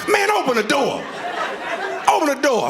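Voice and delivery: high-pitched, insistent mock yell; acted yelling. Locked-out husband demanding his wife open up, acted yelling. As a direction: holler through a locked door. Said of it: "While I'm yelling".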